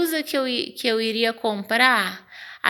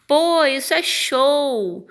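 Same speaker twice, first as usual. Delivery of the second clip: slower, higher, louder cry of delight; delighted exclamation; 5 semitones higher, 4 dB louder, slower than usual. Shown as a demonstration. Teacher showing students how to exclaim something liked, delighted. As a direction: say it like you really loved it. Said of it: "Olha como eu dei uma entonação aí de, de algo que eu gostei".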